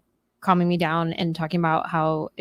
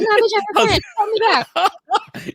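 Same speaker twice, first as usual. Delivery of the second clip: sassy retort; 11 semitones higher than usual. Retold or imitated. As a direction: snap back in a much higher voice, stretching call me back. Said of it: "And I'm like".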